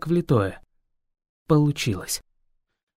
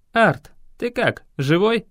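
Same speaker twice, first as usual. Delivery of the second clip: hoarse; 6 semitones higher than usual. A narrator's reading. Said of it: "спросил хрипло".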